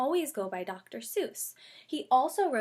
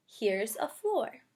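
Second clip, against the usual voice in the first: lively, louder picture-book read-aloud; reading to children; 4 dB louder, slower than usual. Acted. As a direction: read it out for a class of little kids. Teacher reads picture book aloud.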